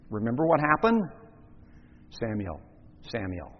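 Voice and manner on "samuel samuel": soft divine call. Acted. God calling the boy Samuel.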